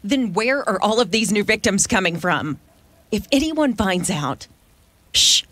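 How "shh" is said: sharp shush. Acted. Hushing for fear of discovery.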